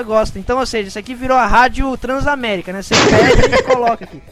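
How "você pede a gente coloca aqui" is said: radio announcer. Pretend. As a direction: sell it like a radio DJ. Mimics radio jingle.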